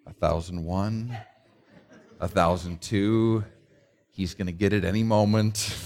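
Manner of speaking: lightly voiced wife silently counting; a hint of the wife's patient counting; wife counting in her head. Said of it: "I could hear her counting in her head".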